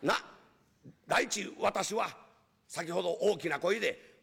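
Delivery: ordinary talk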